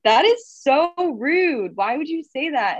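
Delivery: high, slow, indignant retort; drawn-out mock outrage at a rude remark; mock offended. Retelling her retort to the interviewer who called her shirt pajamas, mock offended.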